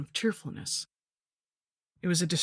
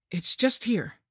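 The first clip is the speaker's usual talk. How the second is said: higher, lightly voiced young man; a hint of an eager boy; youth's voice; 6 semitones higher, slower than usual. A narrator's reading. Dudley voiced by narrator.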